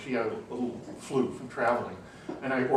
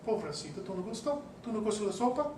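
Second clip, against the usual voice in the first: sympathetic waitress; 9 semitones higher, 4 dB quieter, faster than usual. Retold or imitated. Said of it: "the waitress came over ... and said".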